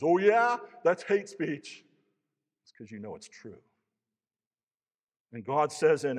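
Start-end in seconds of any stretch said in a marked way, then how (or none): 0.0-1.8 high, loud sarcastic scoff; mocking angry theist. Angry theist scoffing at the preacher, high and sarcastic. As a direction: sneer like an offended skeptic. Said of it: "the angry theist that goes".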